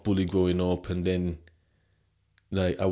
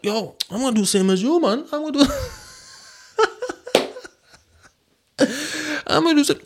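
much higher, louder, faltering bluff; nervous bravado; 3 dB louder, faster than usual. Retold or imitated. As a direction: fake confidence with a laugh. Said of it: "I was like".

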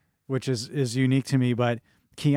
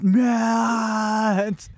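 imitated high, drawn-out scream; Lane; 9 semitones higher, 5 dB louder than usual. Imitating Lane outside calling Matt, long loud scream. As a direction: hold the shout at full blast, mimicking him. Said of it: "He's just screaming".

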